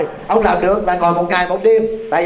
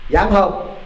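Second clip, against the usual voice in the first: ordinary talk, nothing marked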